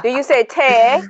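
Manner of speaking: put-on British question; mock British accent. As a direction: imitate a British speaker saying "tea" as "teh". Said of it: "you don't say none of that".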